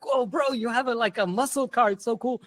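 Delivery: a touch of eager praise for the car; imagined impressed friend. Retold or imitated. Friend admiring the narrator's muscle car, lightly mock-impressed. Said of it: "I thought he'd be so impressed".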